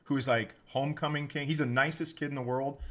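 ordinary talk

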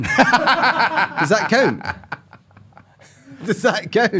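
laughing through the question. Real, not acted. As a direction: giggle while asking. Laughing joke question repeated.

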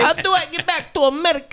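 high, hammed-up Italian voice; fake Italian accent. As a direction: play a lost fake Italian. Italian character bit.

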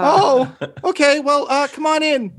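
very high, flustered little voice; small squeaky welcome, hesitant and high; tiny shopkeeper. Acted. Tiny shopkeeper letting visitors in, high and flustered. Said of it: "a little tiny guy".

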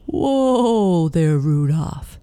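loud, long-drawn whoa; Santa calling out. A narrator's reading. Santa halting Rudolph over the park, loud call. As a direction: rein in the sleigh with a big falling call. Santa calls his reindeer to halt.

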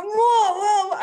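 high loud amazed whoa; kids' whoa. Retold or imitated. The children marvel loudly at the mess. Kids react to the dump.

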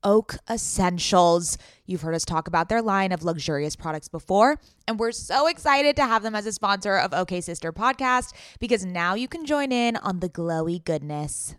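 upbeat sponsored ad read. Acted. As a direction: sell it like a podcast ad.

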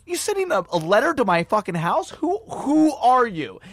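high-pitched indignation, slowing on the final question; outraged questioning. Retold or imitated. Comedian challenges caller about a letter, outraged. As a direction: imitate a man appalled by a stranger.

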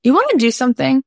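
imitating her chirpy eager invite to the neighbor, fast; eager invitation. Actress inviting her neighbor to make something, bright eager question. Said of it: "She said".

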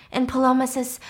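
ordinary delivery

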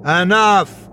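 a sharp, loud cry of Enough; Corey shouting to silence the stranger's taunts; shouted. A narrator's reading. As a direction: cut him off with a yell. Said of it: "Corey shouted".

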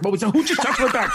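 high-pitched, jumpy mock panic; flipping out. Nervous comic before going on, acting panicked. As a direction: act out jittery alarm, half laughing. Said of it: "I'm flipping out".

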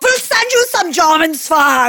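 high, hammed-up German accent. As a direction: exclaim it in a mock German accent. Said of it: "my best German accent".

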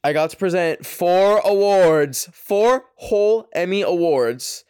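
bursting with pride; excited brag. Real, not acted. Podcaster bragging to viewers about the Emmys, excited.

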